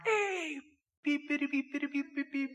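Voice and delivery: high, squealing car-horn beeps; mock road rage. Angry driver cut off in the car, honking in a high mock squeal.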